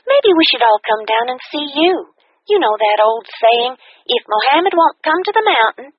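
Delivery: bright, high, fast upbeat friend voice; cheerful friend. A narrator's reading. Kathy invites her friend to dinner, cheerful and high.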